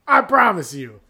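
high, loud spokesman; mock insistence. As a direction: insist like a pushy pitchman.

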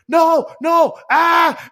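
high yelling no no ah; mock death scream. Retelling the VR game, the friend's sudden ghost scream. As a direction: squeal like the guy getting killed in the game.

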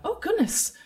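put-on high, startled girl; startled high exclamation; taken aback. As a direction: recoil in surprise, pitched right up. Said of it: "sort of taken aback by that".

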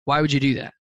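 calm inquiry without accusation; curious why. Shown as a demonstration. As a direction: ask it neutrally, wanting the reason. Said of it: "It's why as in".

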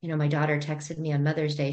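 ordinary delivery

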